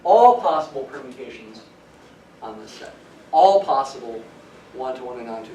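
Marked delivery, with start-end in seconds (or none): 0.0-1.6 all said loud and falling; stress on ALL. Shown as a demonstration. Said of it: "emphasis on the word all". 3.3-4.2 All said loud with a falling tune; emphatic All, then carry on; stress on ALL. Shown as a demonstration. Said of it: "emphasis on the word all".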